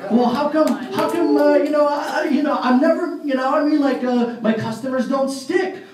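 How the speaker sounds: high-pitched, stumbling mock complaint; acted complaint. Distributor complains that customers don't stick, halting mock complaint. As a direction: whine haltingly as a stuck distributor.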